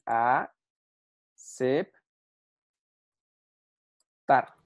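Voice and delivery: "A, cep, tar" with long gaps between syllables. Shown as a demonstration. Teacher showing how to divide aceptar, "A, cep, tar" one by one. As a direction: separate "A, cep, tar", pausing after each. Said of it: "Así deberías dividirla".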